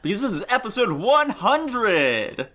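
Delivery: big game show host intro; announcer voice. Acted. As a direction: hype up the show's opening. Host opening a show episode.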